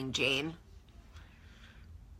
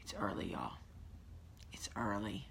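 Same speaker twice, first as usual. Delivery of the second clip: hushed, low, half-whispered grumble; barely voiced early-morning complaint; weary aside; 9 semitones lower, 19 dB quieter than usual. Real, not acted. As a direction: drop to an exasperated near-whisper. Muttered after yelling, early morning.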